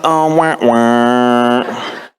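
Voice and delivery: mock failure sound, drawn out; sad-trombone wah-wah. Actor knows he has messed up, mock sad trombone. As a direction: imitate a deflating trombone. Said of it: "I just kinda am like".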